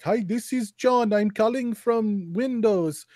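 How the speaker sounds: imitating a scam caller; scammer impression. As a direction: put on a scammer's phone voice. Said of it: "the scammers who'll call you and be like".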